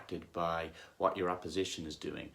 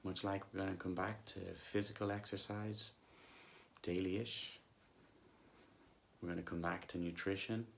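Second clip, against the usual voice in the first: soft, unhurried meditation voice with long pauses; guided meditation; 7 dB quieter than usual. Acted. Coach leading listeners back to body and breath, calm guided meditation. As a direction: gently guide them back to the breath, leaving long silences.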